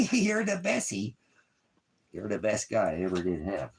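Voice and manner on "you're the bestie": high, slow squeal of affection. Real, not acted. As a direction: gush over the puppy in a high voice. Cooing at the dog.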